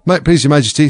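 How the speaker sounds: high-pitched, deferential address; very humble. A narrator's reading. Gardener addresses the Queen, very humbly. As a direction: approach royalty meekly. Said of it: "in a very humble tone".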